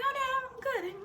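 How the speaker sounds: very high, squeaky fake-brave voice; mock tearful denial. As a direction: imitate holding back tears. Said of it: "You cried?".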